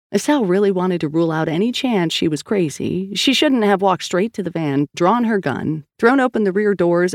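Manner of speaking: usual speaking voice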